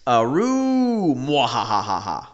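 evil cackle, drawn out slowly; hammy villain laugh; mad scientist. Acted. Evil laugh in Nixon quote.

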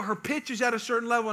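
ordinary delivery

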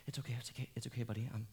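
ordinary talk

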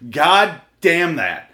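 loud, high, drawn-out angry curse; exasperated outburst. Real, not acted. Host cursing a phone that won't stop going off, exasperated. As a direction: curse it slowly, hitting each word hard. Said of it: "driving me insane".

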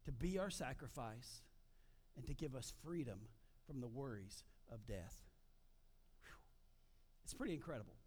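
ordinary talk, nothing marked

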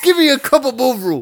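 hammed-up British squawk; mock British accent. Bandmate clowning on the podcast about [name], hammed-up British accent. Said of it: "starts in on the British accent".

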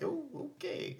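very soft, high little okay; mock meek acceptance; taken-aback reaction. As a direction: back away with a small high voice. Acting own taken-aback reaction.

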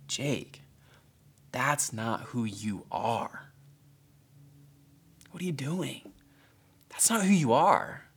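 soft, slow, tender rebuke from a loving teacher; gentle compassionate teacher. Retold or imitated. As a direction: say it softly, full of compassion. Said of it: "with eyes of solidarity and compassion".

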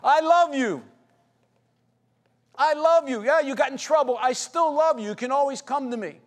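put-on a parent forgiving a rebellious child who got in trouble; loving parent. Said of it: "it's like a parent with a rebellious child".

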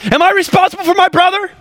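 high, fast, offended retort; indignant Cain. Acted. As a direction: Cain bristling at an unfair question. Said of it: "this is what happens to Cain".

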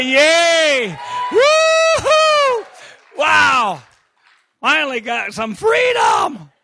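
high, loud whooping joy; jubilant cheering. Retold or imitated. Preacher leading the crowd, loud joyful whoops. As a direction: celebrate like you've just been freed. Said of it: "Someone say".

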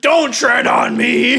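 gun nut voice. Acted. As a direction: proclaim it like a proud gun nut. Mocking gun-rights Santa.

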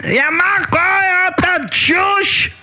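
high fake German gibberish; mock German accent. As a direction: ham up a German rant.

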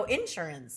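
"insurance" with the IN emphasized; stress on first syllable. Shown as a demonstration. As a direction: punch the IN of "insurance". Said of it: "I think maybe I do".

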